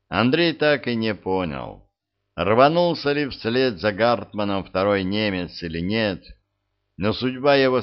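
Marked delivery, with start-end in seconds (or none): none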